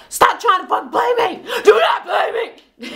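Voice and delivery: high, over-the-top exasperated protest; hammed-up outburst. Nico blows up at being blamed, hammed-up outburst. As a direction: imitate a man losing it, pitch shooting way up. Retold outburst, then "so extra.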